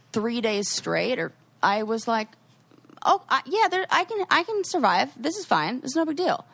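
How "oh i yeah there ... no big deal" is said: high-pitched, brushing it off; mock-cheerful insistence on coping; breezy self-reassurance. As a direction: make light of it, voice pitched up. Acts out breezy self-reassurance after hardship.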